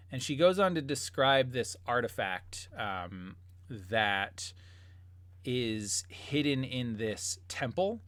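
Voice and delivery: ordinary delivery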